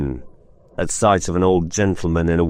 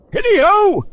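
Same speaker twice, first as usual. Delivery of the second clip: jovial Fezziwig; 5 dB louder than usual. Acted. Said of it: "cried old Fezziwig".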